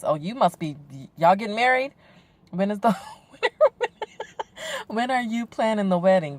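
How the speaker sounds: mock teasing. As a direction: poke fun at nosy church folks. Mocking people's teasing questions.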